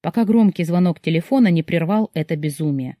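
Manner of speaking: usual speaking voice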